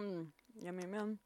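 soft words between bites; chewing while talking. Real, not acted. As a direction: mouth full, savoring. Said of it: "we're just chewing nowadays".

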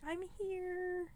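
soft, high announcement; quiet arrival. Retold or imitated. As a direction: slip in quietly. Entering hospital room.

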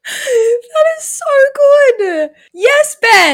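loud, high-pitched delight; real delighted outburst. Real, not acted. Co-host reacting to the clogged toilet story, delighted outburst.

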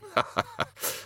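breathless uneasy little laugh; nervous laugh. Acted. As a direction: laugh to cover the awkwardness. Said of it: "he laughed nervously".

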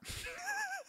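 shrill giggle breaking out; high burst of laughter. Real, not acted. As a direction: squeal of real laughter. Laughing at own punchline.

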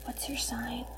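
whispered ASMR chat; playful whispered small talk. Pretend. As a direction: keep it to a hushed whisper. Playing ASMR whispering game.

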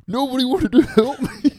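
high, loud wounded whine; whiny complaint. Retold or imitated. Rhodes whining to his friends, high and loud. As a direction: whine like a sulky victim. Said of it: "Rhodes ju".

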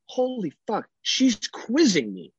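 high, slow dawning shock; stunned realization. Retold or imitated. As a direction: let it sink in with a high, slow gasp. Dad realizes baby quizzes him.

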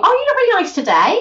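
high, lilting, sincere-sounding compliment; nice compliment. Shown as a demonstration. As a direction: say it brightly, rising high as if truly meaning it. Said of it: "that sounds nice".

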